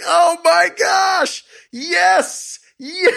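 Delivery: very high, slow, shouted squeal of joy ending in a laugh; ecstatic squeal. Retold or imitated. Husband squealing with joy at the contract news. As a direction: squeal like you just won the lottery. Retelling the joyful contract call.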